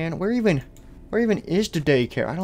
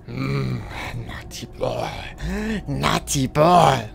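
creepy night persona; much slower than usual. Acted. Dark alter persona Moon.

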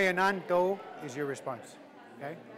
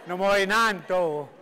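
"Namoy Nanto" stretched slowly and loudly; emphatic; 7 dB louder, slower than usual. Shown as a demonstration. Teacher modelling the response for students, "Namoy Nanto" slow and emphatic. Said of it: "put some emphasis in it".

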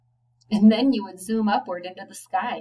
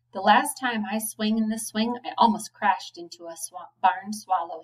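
plain reading voice

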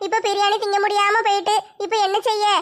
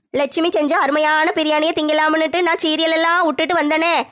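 a grumbling elder, lower voice; lower, fed-up neighbour lament; lower character voice; 4 semitones lower than usual. Acted. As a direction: complain as the disappointed neighbour.

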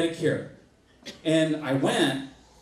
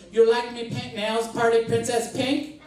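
put-on higher-pitched caricature of a foreign accent; pitched-up broken-English foreign accent; mock French lady accent; 5 semitones higher than usual. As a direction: imitate the salon lady's accent, offering sweetly. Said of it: "the French lady, she looks at me".

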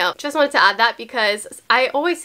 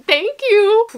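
high, elated squeal of gratitude; overjoyed thanks; 8 semitones higher, 4 dB louder than usual. Real, not acted. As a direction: sing out your thanks high and thrilled. Thanking brand for surprise gift.

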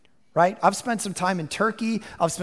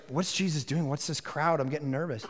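soft, lower, a touch anxious; mildly nervous mom; 5 semitones lower, 9 dB quieter than usual. Retold or imitated. Mary outside the crowded house, softly nervous. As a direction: play his worried mother under your breath.

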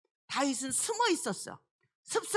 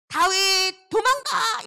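high, slow, louder cry to flee; warning shout; 6 semitones higher, 6 dB louder, much slower than usual. Pretend. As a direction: yell the warning across the field. Imagined loud warning shout. (다급하게 외치는 경고)

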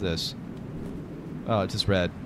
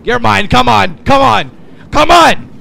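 building, louder and higher taunts; taunting shout; 5 semitones higher, 8 dB louder than usual. Real, not acted. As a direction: egg the enemy on, rising. Taunting enemy mid-fight.

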